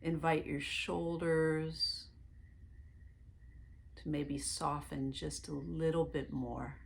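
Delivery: calm meditation guide voice; guided meditation. Acted. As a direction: lead them gently into relaxation, one breath at a time.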